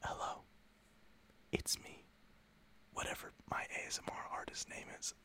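breathy hushed whisper, barely voiced; soft whispered greeting to the camera viewers; asmr whisper intro. Shown as a demonstration. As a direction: whisper like an ASMR video opening. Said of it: "They always start their videos like this".

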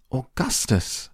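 high-pitched call, no real volume; mock shout. A narrator's reading. Mother calling her son away from the river, high mock shout. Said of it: "shouted Missus Gloop".

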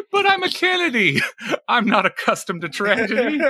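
put-on high laughing Boston accent, JFK-style; laughing Kennedy impression. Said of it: "like J F K".

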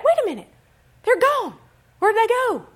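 loud, high-pitched indignant cries; mock outrage. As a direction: exclaim in hammed-up anger at being cheated. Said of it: "You're mad".

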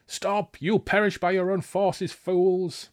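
high-pitched acted shout; shouted warning. Pursuer warning riders of their own forces, shouting. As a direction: call it after fleeing riders.

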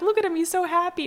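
lightly voiced gleeful Daryl; telling a friend about the squirrel scene, high and delighted; imitating Daryl. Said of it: "Daryl's like".

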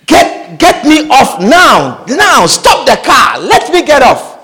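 drawn-out, emphatic order to stop; urgent demand. Pretend. Passenger to gossiping driver wanting out of the car, urgent demand. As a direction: demand it forcefully, stretching each command.

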